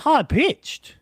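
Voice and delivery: lightly imitating a voice going high; drawn-out mock high-pitched jump. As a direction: lift the end of "high pitched" upward. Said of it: "then he goes high pitched".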